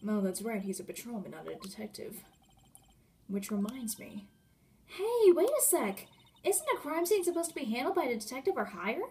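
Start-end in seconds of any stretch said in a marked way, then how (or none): 4.9-9.0 put-on eager young investigator, objecting. Investigator questions who handles the scene, lively objection. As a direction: cut in with a lively challenge.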